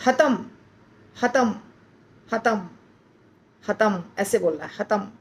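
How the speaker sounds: हतम with the final म barely heard; हतम ending in a half-voiced म; soft final m. Shown as a demonstration. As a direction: close हतम softly, swallow the म. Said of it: "म सुनाई नहीं देना चाहिए पूरा".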